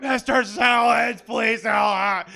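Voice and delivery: put-on high, loud, desperate kid's shout; kid yelling for help. Kid yelling to teacher for rescue, high and loud. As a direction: scream for rescue like a stranded kid.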